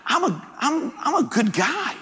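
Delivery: high-pitched, stammering plea; mock protest. Patient protests his diagnosis to doctor, high mock plea. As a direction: plead innocence in a comically high voice.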